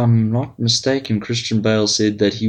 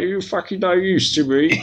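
mock outrage; 7 semitones higher than usual. Mock berating of the co-host.

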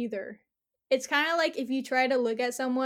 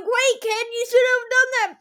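put-on much higher, louder call after a running kid; 11 semitones higher, 8 dB louder than usual. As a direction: shout it after the kid as he runs. Calling after fleeing kid.

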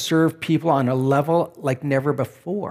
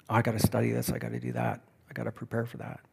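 very soft, low student's to-do list; muttered inner worry; 4 semitones lower, 10 dB quieter, much faster than usual. Acted. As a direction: mutter it under your breath, fading away, as a student's thoughts. Voices students' thoughts about pending work.

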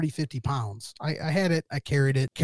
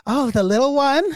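high, rising mock question; imitated woman's voice, lifted at the end; mimicked roommate; 9 semitones higher, 5 dB louder than usual. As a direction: pipe up as the roommate, pitched high.

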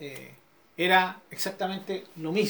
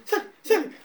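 high, mock order to a dog; dog command; 12 semitones higher than usual. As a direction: shoo the dog out, playfully. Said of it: "y dice".

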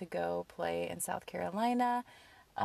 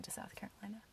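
whisper; 14 dB quieter, faster than usual. Real, not acted. Whispered news to parents.

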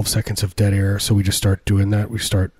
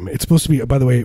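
ordinary talk

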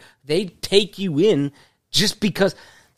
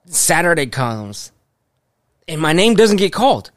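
ordinary talk

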